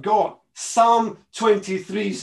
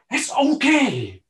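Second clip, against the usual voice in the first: reassuring; 8 semitones higher, slower than usual. Acted. Jesus comforting disciples.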